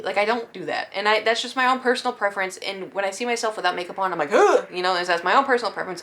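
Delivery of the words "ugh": loud, high grunt of disgust; disgusted "ugh". Acted. Disgust at own bare face.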